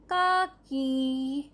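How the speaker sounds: काकी drawn out very slowly, each syllable clear; slow spelled-out word. Shown as a demonstration. Teacher showing pupils the new word, slowly drawing out काकी. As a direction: stretch काकी out slowly for the class. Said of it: "तो यह बन जाएगा".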